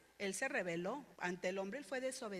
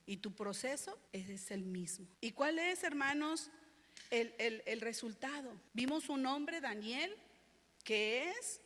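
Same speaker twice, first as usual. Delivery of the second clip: ordinary talk, nothing marked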